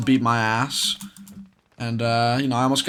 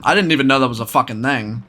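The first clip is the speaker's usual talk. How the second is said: ordinary delivery, nothing marked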